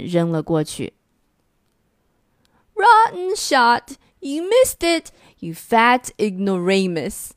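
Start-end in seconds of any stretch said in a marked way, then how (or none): 2.7-7.3 high, slow mocking yell; jeering shout. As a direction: crow over the missed throw. Monsters trading jeers and stones.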